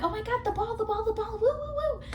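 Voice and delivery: mock excited squealing. Vlogger mocking the band thrilled about the ball, excited squeal. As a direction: gush over the ball. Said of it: "they're all like".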